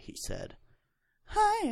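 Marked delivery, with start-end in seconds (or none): 1.3-1.7 much higher, breathy-free sing-song hi; mock flirty greeting. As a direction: flirt it up high. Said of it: "I replied flirtily".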